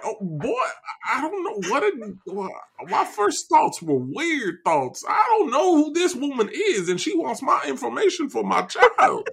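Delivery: blustery Southern cartoon rooster, high-swinging pitch; Foghorn Leghorn. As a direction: bluster like an indignant Southern gentleman. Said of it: "Foghorn Leghorn is his name".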